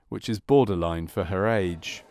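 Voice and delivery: ordinary talk, nothing marked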